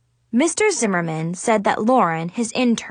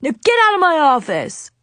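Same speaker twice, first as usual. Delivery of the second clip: loud, high-pitched order to leave; angry yell; 6 semitones higher, 5 dB louder than usual. A narrator's reading. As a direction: throw them out with a shout. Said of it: "he yelled".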